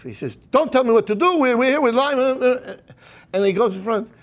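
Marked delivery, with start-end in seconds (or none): 0.5-2.2 retold yelling. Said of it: "he starts yelling at his wife".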